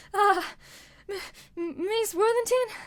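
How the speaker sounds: high, stammering niece's voice; a girl stammering out her aunt's name; frightened niece. Acted.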